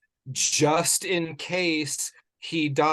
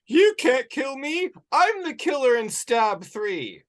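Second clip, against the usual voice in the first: high mock quote; 12 semitones higher, 4 dB louder than usual. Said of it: "she's like".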